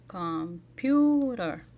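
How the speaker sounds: "Computer" very slowly, with a big, loud "pu"; over-articulate and stretch the middle of "Computer"; "Computer" hugely drawn out, second syllable stressed; exaggerated stressed syllable. Shown as a demonstration. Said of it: "it uses larger facial movements".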